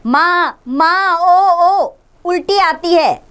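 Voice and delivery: put-on high, drawn-out child's groan of nausea; loud groaning. Sick boy Mohan groaning to his mother about vomiting. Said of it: "Mohan जोर से करारता है".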